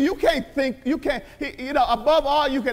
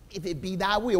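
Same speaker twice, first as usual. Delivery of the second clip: mock timid prayer; 8 semitones lower, 7 dB quieter than usual.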